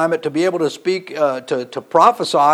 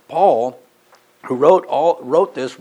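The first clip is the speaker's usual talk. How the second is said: ordinary talk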